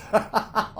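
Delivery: airy unvoiced giggle; breathy laugh. Real, not acted. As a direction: let a breathy laugh escape. Laughs at landlord's joke.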